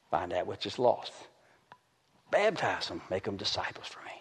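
soft whispered command; whispered charge. Acted. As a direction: breathe it like a divine secret. Quiet voice of God.

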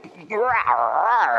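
imitated growl of a tiny dog; mock puppy growl. Preacher imitates a puppy trying to bite him, growling playfully.

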